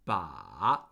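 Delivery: Ba dipping very low, then rising; third tone on Ba. Shown as a demonstration. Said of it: "We're practicing the third tone".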